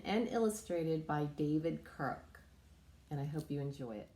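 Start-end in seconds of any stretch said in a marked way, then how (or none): none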